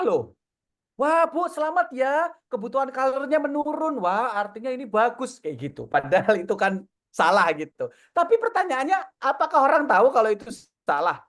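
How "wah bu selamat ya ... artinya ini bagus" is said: eager congratulations. Retold or imitated. Retells his own mistaken congratulations.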